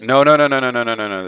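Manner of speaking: emphatic no. Real, not acted. Protests the fourteen minutes.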